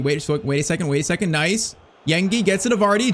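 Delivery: normal talking voice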